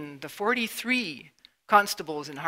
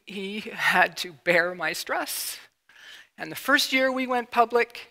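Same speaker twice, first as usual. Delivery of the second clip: normal talking voice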